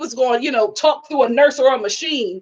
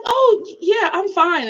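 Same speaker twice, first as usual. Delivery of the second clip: high-pitched cover-up; mock breezy denial; 6 semitones higher, slower than usual. Child reassuring mom on a bad day, falsely breezy. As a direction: brush it off in a higher voice. Child brightly pretending to be fine.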